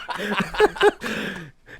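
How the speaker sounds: high, loud belly laugh; laughing hard at oneself; helpless laughter. Real, not acted. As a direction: burst of uncontrollable laughing. Cracks up after sensual attempt.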